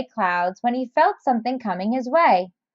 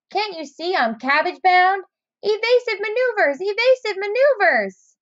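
high, fast evasive cry; excited alarm; 8 semitones higher than usual. Acted. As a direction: rattle it off while dodging. Snail dodging the crow.

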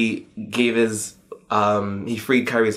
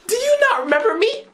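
much higher and fast, squealing the question; a fan's stunned high cry at the screen; high-pitched disbelief; faster than usual. Retold or imitated. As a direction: shriek it out, overwhelmed fan. Reacting to a game scene.

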